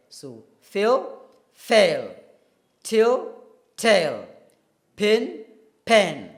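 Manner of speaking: loud clear vowel pairs. Shown as a demonstration. Teacher drilling pin and pen for the class. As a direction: project each pair to the class. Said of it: "experimenting between".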